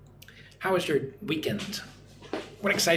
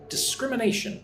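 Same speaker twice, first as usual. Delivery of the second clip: "Discrimination" said whole, higher, stressing "scrim"; second-syllable stress; 8 semitones higher than usual. Shown as a demonstration. Teacher modelling the word for learners, stress on the second syllable. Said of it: "Put the stress on the second syllable".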